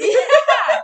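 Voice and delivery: high, loud laughing yeah. Real, not acted. Agreeing with her friend through a loud laugh. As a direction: laugh the yes out.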